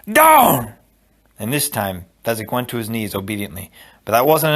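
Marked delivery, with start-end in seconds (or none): 0.1-0.7 high, loud one-word bellow; hollered command. A narrator's reading. Inigo slamming the giant's shoulder, hollered command. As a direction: holler it with all your strength.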